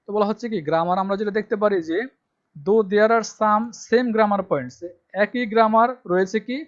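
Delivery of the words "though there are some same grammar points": reads the English line out very slowly and loudly, word by word; slow dictation reading. Shown as a demonstration. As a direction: spell it out slowly for students to copy. Rereading syllabus line. (ধীরে ধীরে জোরে পড়ে শোনানো)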